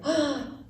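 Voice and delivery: quick high gasp; sharp storybook gasp; surprised gasp. Acted. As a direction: gasp as the ball drops. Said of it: "ball high into the air".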